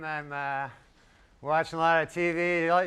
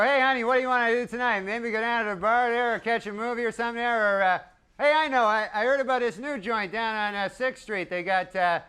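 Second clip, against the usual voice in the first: mock date-night chatter; 5 semitones higher than usual. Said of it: "you never hang around and go".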